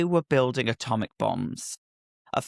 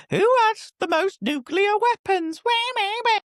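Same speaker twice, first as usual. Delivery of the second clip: squeaky high voice; 4 dB louder than usual. Pretend. Mocking siren-like squeal.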